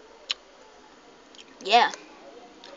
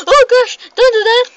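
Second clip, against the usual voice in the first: high, loud gasp and protest; startled shriek; 14 semitones higher, 14 dB louder, faster than usual. Real, not acted. Player startled while signing off, shrill protesting shriek. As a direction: shriek out as if jumped on.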